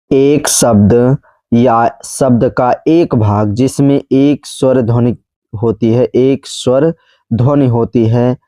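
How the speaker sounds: slow, spaced-out definition; measured teacher repeat, pausing between phrases; slow dictation. Shown as a demonstration. Repeats definition slowly for learners.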